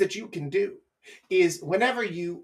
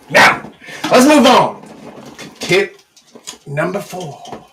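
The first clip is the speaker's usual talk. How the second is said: loud transition; 10 dB louder than usual. Real, not acted. Host moving on to the next tip, loud. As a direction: belt it out to change topic.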